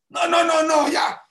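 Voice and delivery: high, loud cutting-off protest; angry refusal. Acted. Rebels refuse Moshe's offer to listen, high angry shout.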